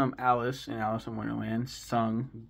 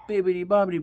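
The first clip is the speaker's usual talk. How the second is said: higher, louder singsong fairy godmother; fairy godmother bit; 8 semitones higher, 9 dB louder, much faster than usual. Retold or imitated. Quoting the fairy godmother in a singsong voice. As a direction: lilt it like a kindly fairy.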